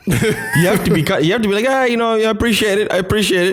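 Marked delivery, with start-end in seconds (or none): 1.7-3.5 mock gracious thanks. Imitating politician thanks.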